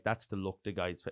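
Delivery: usual speaking voice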